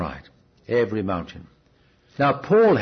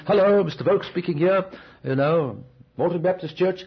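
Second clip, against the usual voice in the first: formal phone greeting; 5 semitones higher than usual. Retold or imitated. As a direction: put on your best voice for an important caller. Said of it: "thinking it was the Archbishop of Canterbury".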